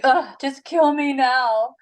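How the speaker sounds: mock a sulking baby begging to die; whiny Jonah. Jonah whining to God about the heat, mock whiny. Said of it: "he's just being a baby".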